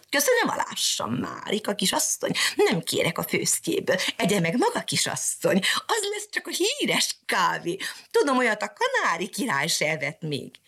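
high, teasing bird voice; mocking canary. Canary mocking Mariska, high chirpy voice. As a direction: tease her in a chirpy bird voice. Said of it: "csúfolódva pittyentette".

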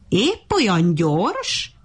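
slow, drawn-out taunting question; scornfully amused, no laugh; mocking amusement. A narrator's reading. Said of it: "a hasát fogta nevettében".

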